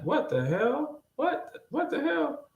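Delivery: stunned squeak of confusion, acted out; baffled disbelief. Worker reacts to the teen's odd reply, baffled disbelief.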